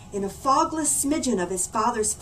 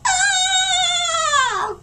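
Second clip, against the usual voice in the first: shrill very high scream, loud; boy's scream; 6 dB louder than usual. Acted. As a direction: let out a terrified shriek. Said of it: "Oliver's scream shook".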